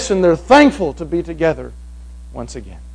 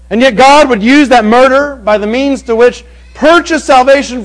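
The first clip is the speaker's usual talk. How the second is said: loud, raised preaching build; preaching at full force; 6 semitones higher, 7 dB louder than usual. Acted. Preacher proclaiming salvation to the congregation, full-voiced preaching. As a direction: proclaim it to the whole room.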